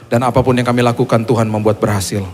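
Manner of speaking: loud, projected congregational prayer; leading prayer. Real, not acted. Leads the closing congregational prayer. (doa penutup yang lantang untuk jemaat)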